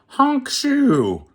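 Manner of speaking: high, loud honking snore; a princess snoring loud; sputtering snore. Acted. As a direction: honk out a cartoon snore. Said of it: "she sputtered".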